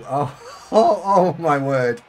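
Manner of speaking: bursting out laughing, loud and high; oh oh through real laughter. Real, not acted. Streamer cracking up at a chat message.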